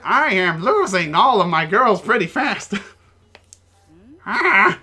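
a hint of a fussy old woman. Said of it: "It's our old woman voice".

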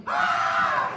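long, loud staged scream; mock scream. Wife screams while Joseph looks on, staged scream. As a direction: scream it out and hold it. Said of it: "And then she screamed".

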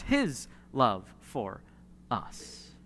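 slow, pressing "his", "us" fading to a whisper; "his love for" drawn out slowly, then "us" breathed unvoiced; personal, intimate emphasis; stress on his love for us. Shown as a demonstration. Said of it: "it's personalized".

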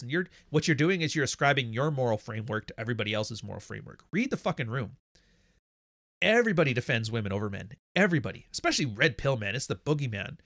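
ordinary delivery

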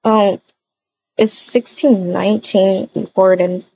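normal talking voice

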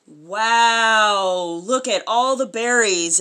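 ordinary delivery, nothing marked

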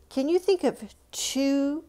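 ordinary talk

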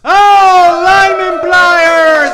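very loud, high, slow whoop at the prize; game-show prize reveal; excited shout. Real, not acted. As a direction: yell out the big win.

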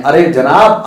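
ordinary talk

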